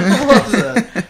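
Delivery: loud, slow, laughing question. Real, not acted. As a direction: crack up while asking. Laughing at the grunt.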